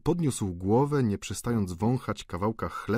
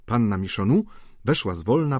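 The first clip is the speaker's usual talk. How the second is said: ordinary delivery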